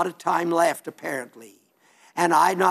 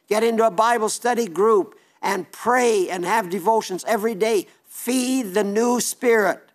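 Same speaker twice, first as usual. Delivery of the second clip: rising preaching exhortation; 4 semitones higher than usual. Acted. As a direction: urge the flock on with growing fervour. Exhorting listeners to Bible habits.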